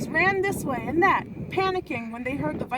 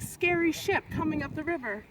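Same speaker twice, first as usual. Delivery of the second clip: plain reading voice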